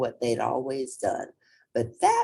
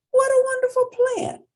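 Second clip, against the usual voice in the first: much higher, louder squealing hen voice; delighted hen; 7 dB louder than usual. A narrator's reading. A hen thrilled by the hound's plan, squealing high and loud.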